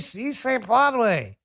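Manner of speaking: "puede" said as "pwad-way", high and dragged out; mispronounced Spanish. Retold or imitated. Host ridiculing bad Spanish, "puede" as a slow "pwad-way". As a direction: drawl "Si se puede" slowly with a botched "pwad-way". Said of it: "it's not si se puede".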